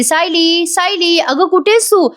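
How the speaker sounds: high, lightly acted calling. A narrator's reading. Woman searching for her missing sister-in-law, mild narrated yell. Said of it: "अस्मिता पण ओरडत असते".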